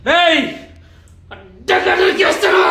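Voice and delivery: high, loud threatening yell; villain shout. Acted.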